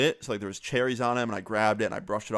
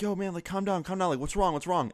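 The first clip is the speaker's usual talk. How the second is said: higher-pitched anxious pleading; mildly panicked, reenacted urgency; retold freaking out; 8 semitones higher than usual. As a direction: try to calm a panicking friend, voice raised in pitch. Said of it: "me and Logan start freaking out".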